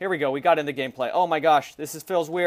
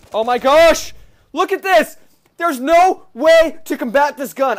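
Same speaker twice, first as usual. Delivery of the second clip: much higher, louder disbelief; outraged shouting; 9 semitones higher, 9 dB louder, slower than usual. Real, not acted. Gamer shouts at his viewers about the unbeatable gun. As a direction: yell it at the screen in disbelief.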